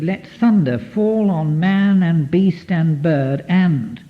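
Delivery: slow, measured poetic recital; poem recital. Shown as a demonstration. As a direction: lean into each beat of the verse, slowly. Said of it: "it finishes with".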